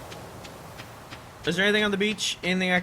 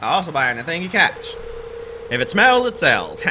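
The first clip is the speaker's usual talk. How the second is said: sailor voice; 3 semitones higher than usual. Acted. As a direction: sell it like a cheery old sailor. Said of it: "This is my sailor voice".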